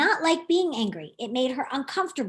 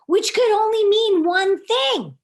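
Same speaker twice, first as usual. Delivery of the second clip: higher, louder, slow reveal; dramatic build; 6 semitones higher, 6 dB louder, slower than usual. A narrator's reading. Narrator building to the realization, higher and slow. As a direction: lean into the suspense.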